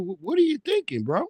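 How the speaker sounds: high disbelieving question; incredulous. Real, not acted. Streamer asking Tim Tebow in high disbelief.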